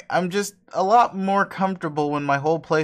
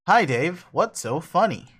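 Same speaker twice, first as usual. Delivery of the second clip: plain reading voice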